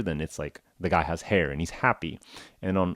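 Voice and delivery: ordinary talk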